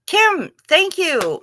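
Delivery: delighted thanks. Real, not acted. Thanking a viewer for a compliment.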